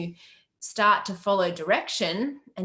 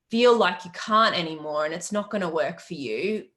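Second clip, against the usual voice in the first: normal talking voice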